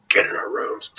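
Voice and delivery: hushed, whispered warning to a sibling; urgent whisper. Retold or imitated. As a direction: whisper it so the grown-ups won't hear. Eavesdropping children flee unseen.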